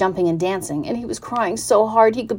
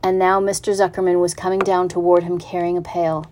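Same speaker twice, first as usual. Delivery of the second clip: plain reading voice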